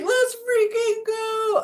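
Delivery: very high, slow cheer; excited. Pretend. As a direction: cheer like a pumped-up bird. Voicing the bird excitedly.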